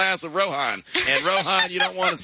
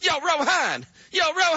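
street greeting; 10 semitones higher than usual. Pretend. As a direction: holler at your buddy on the block. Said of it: "You sound like a brother".

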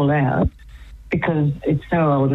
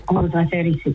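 ordinary talk, nothing marked

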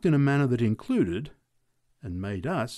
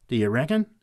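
loud sarcastic retort; dry disbelief; 2 semitones higher, 6 dB louder than usual. A narrator's reading. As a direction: toss it back over your shoulder, mocking. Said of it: "replied sarcastically over his shoulder".

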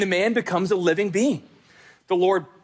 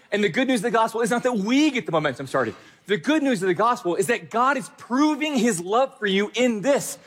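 ordinary delivery

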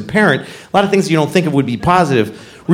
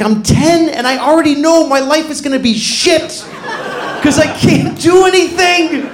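put-on whiny kid self; 9 semitones higher than usual. Ten-year-old self despairs.

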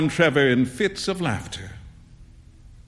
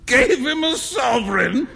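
high incredulous shout; 10 semitones higher than usual. A narrator's reading. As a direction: shout it in disbelief. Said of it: "shouted Trevor".

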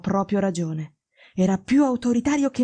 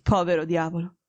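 ordinary talk

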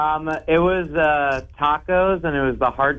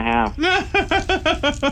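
laughing through the words. Real, not acted. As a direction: giggle it out. Laughs while answering.

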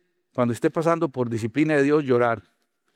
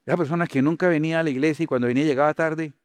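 ordinary delivery

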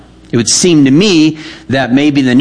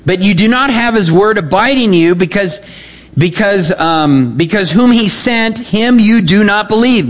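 raised, declaiming scripture voice; scripture reading; 6 semitones higher than usual. Acted. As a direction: proclaim the verses from the pulpit. Reads Jesus' words aloud.

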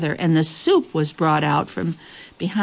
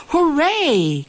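high, drawn-out joyful hooray; excited cry; 10 semitones higher than usual. A narrator's reading. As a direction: cry out in delight at a visitor. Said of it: "cried the queen".

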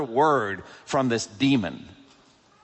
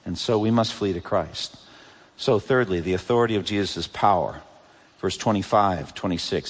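ordinary talk

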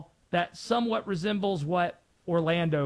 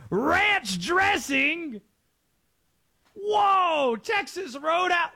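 high, shouted tourist delight; excited tourist; 12 semitones higher, much slower than usual. Retold or imitated. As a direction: gush over it like a tourist. Mimicking excited international fan.

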